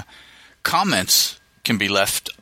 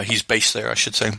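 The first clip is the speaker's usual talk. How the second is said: usual speaking voice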